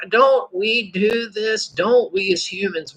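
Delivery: normal talking voice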